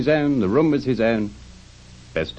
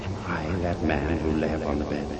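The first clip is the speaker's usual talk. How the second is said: low, softer voice of dawning dread; hushed dread; 4 semitones lower, 5 dB quieter than usual. Acted. Scrooge to the spirit at his own grave, in hushed dread. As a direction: ask it low and quietly, fearful of the answer.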